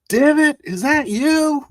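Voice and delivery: high, loud, drawn-out shout; high-pitched incredulous yell. Acted. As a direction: holler the question across a huge cavern. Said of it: "I yell out".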